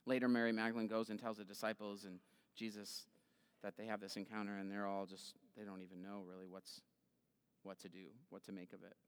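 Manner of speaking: low, very soft, trailing away; subdued, fading narration; hushed storytelling. Real, not acted. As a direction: let the story fade into near silence. Hushed close of the resurrection story.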